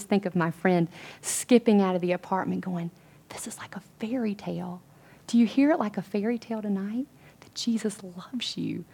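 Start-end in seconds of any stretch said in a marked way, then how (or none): 3.3-4.8 near-whispered retold amazement; soft, breath-only awe; hushed wonder. 7.4-8.9 slow, near-whispered assurance; intimate, slow whisper to listeners; tender hush. Real, not acted.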